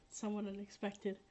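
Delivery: ordinary delivery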